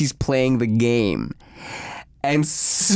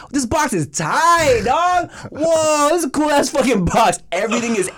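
hyped-up mock yell; excited shouting; 9 semitones higher than usual. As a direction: yell it like the coolest thing you've seen. Excited quote of himself retold.

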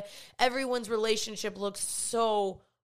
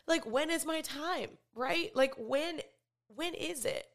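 higher-pitched, emotional plea; getting emotional; 5 semitones higher than usual. Real, not acted. Asking God when it is her time, emotional. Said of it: "I'm getting emotional".

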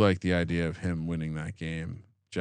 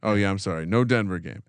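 ordinary talk

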